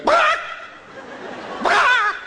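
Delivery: loud, piercingly high chicken bawk; chicken squawk. Acted. Chicken in a corner missing its fingers, squawking. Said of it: "is a chicken going".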